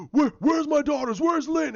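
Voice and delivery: high, loud panicked cry for his daughters; a father calling out in terror; frantic father. Retold or imitated. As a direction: shout for your missing kids in panic.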